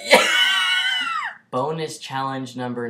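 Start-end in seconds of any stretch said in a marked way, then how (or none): 0.3-1.4 high squealing laugh; shrieking laugh. Real, not acted. Cake shoved in face.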